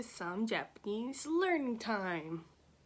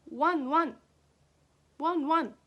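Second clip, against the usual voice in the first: loud playful wan wan; Japanese dog bark; 6 semitones higher, 7 dB louder than usual. Shown as a demonstration. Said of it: "dog cries".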